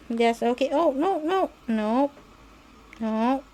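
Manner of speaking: usual speaking voice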